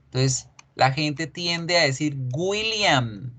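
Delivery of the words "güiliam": "William" said as Spanish GÜI-liam; mispronounced W. Shown as a demonstration. Teacher showing the common mistake, "William" as GÜILIAM. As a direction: put a hard G before the W of "William". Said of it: "como una G y una U con diéresis".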